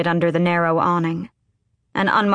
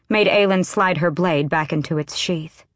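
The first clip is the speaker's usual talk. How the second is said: usual speaking voice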